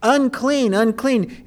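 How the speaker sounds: high ringing call of unclean; a preacher voicing the leper's cry. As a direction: call it out like a leper warning the crowd. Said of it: "he would begin to cry out".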